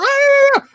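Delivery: very high, loud imitated shriek; mock scream. Fan imitating Copper being woken, high loud scream.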